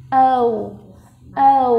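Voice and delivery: ordinary talk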